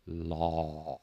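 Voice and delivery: "law" with a low, open aw from the throat; deep aw sound. Shown as a demonstration. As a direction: growl "law" from deep in the chest. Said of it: "I kind of draw the corners of my mouth back".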